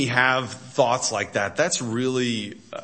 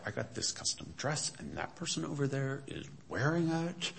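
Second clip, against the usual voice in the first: very hushed mock complaint; much softer voice, quietly aggrieved; upset, imitated; 3 semitones higher, 11 dB quieter than usual. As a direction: imitate an upset woman grumbling confidentially. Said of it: "and she was upset".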